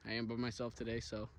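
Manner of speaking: ordinary talk, nothing marked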